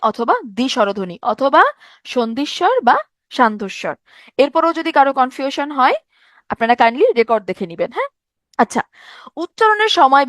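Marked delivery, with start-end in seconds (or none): none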